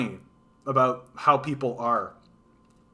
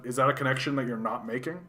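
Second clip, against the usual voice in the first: ordinary talk, nothing marked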